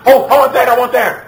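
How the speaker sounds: put-on high, loud, excited kid voice; demanding child. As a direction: play a child clamoring for something in a store.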